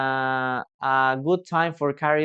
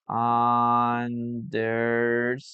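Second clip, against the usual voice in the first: very slow model word; 3 semitones lower, much slower than usual. Shown as a demonstration. Teacher writes and slowly sounds out Understood. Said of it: "Let's put it here".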